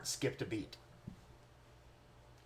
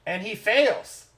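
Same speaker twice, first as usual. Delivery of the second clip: raised, building storytelling with pauses; dramatic game master narration; 3 semitones higher, 4 dB louder than usual. A narrator's reading. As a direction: narrate a mock tragedy, swelling to the end. Game master narrates the deer's death.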